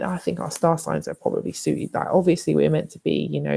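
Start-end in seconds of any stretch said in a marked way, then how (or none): none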